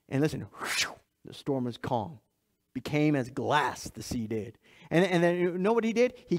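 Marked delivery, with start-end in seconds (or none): 0.1-4.6 much softer, low and hushed as the sea stills; hushed calm. Acted. Preacher describing the calmed sea, softer and lower, hushed. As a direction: let the voice fall quiet like glass water.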